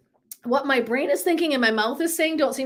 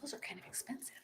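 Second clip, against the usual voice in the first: whispered worry about price; mock husband whisper; 12 dB quieter than usual. Imitating husband balking at ticket prices, whispered. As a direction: hush it like a nervous husband. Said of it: "And he's doing this".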